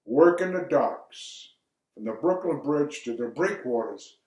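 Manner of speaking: usual speaking voice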